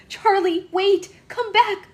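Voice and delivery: a bride calling after her fleeing groom; desperate pleading. A narrator's reading. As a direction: shout after him, begging. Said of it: "she pleaded".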